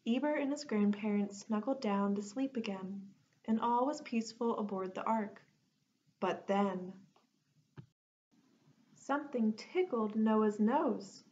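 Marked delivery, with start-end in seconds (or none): none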